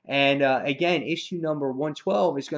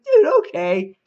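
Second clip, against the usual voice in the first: acted delight; 6 semitones higher, 10 dB louder, slower than usual. Acting delighted acceptance of invitation.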